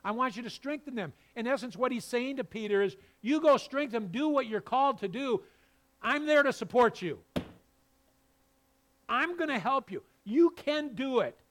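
loud, high, driving sermon voice; full-force preaching. Acted. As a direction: preach it out to the pews. Voicing God's charge to Peter.

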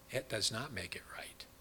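whisper. Real, not acted. Preacher drops to a hush.

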